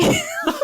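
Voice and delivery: very high burst of laughter. Real, not acted. Doctor amused by own disillusion, laughing. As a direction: laugh helplessly at yourself.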